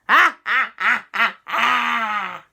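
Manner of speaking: loud, measured "ah-ah-ah" cackle; villain laugh. Acted. Pirate ghost gloats over his treasure, loud villain laugh. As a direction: boom out a staged evil laugh.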